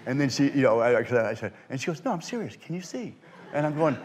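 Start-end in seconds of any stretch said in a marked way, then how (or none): 2.0-3.2 high, soft imitation of pressing someone; hushed insistence. Actress presses the magician for his secret, hushed and insistent. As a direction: press quietly for the truth.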